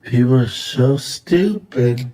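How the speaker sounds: People are so stupid. said slowly in a changed, deadpan voice; slow, deadpan repeat. Real, not acted. Repeating his verdict on people to his cohost, slow and deadpan. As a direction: draw it out, dry and disbelieving.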